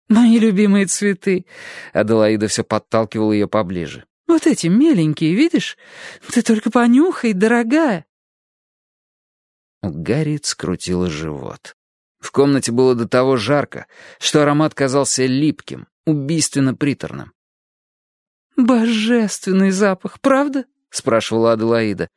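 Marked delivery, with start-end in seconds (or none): 0.1-1.4 high, louder fluttery delight; gushing old lady. A narrator's reading. Adelaide voiced by narrator. 4.3-8.0 high sugary coaxing; gushing old lady. A narrator's reading. Adelaide voiced by narrator. 18.6-20.6 high, slow swooning delight; gushing old lady. A narrator's reading. Adelaide voiced by narrator.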